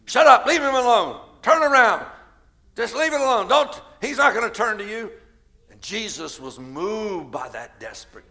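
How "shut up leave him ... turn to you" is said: raised, higher scolding voice; shushing impatiently. Acted. Disciples silencing blind Bartimaeus, impatient scolding. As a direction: shut him up with loud, pitched-up commands. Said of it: "wanted to shut him up".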